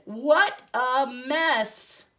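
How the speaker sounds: slow, loud drawn-out exclaim; mock dismay. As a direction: stretch it out in playful shock. Ben sees the mess.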